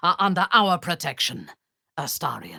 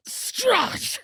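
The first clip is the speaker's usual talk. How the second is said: Strahd! called out much higher, ringing; startled high cry of Strahd!; calling out. Acted. Calling out the name.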